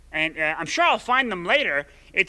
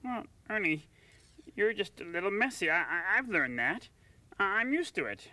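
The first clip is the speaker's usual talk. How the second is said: sleepy hushed voice; 13 dB quieter than usual. Acted. Whispering in bed at night.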